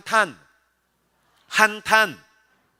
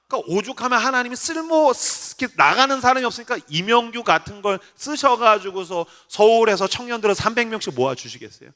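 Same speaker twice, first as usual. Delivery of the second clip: normal talking voice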